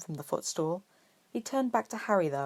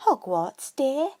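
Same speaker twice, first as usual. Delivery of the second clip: higher, louder bustling witch voice; brisk, loud, high shopkeeper voice; chirpy shop witch; 8 semitones higher, 7 dB louder, slower than usual. Acted. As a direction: greet him like a busy seamstress. Shop witch greets customer.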